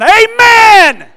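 huge, high, long-held AMEN falling at the end; booming revival shout. Real, not acted. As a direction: belt out a drawn-out amen.

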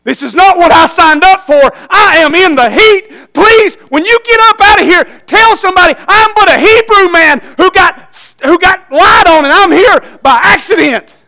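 preacher-voiced Joseph wailing in the heat; anguished plea. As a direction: cry out like a wrongly jailed man. Joseph begging to be remembered.

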